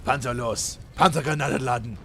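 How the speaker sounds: barked German tank orders, loud; German commander. Pretend. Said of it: "German tanks".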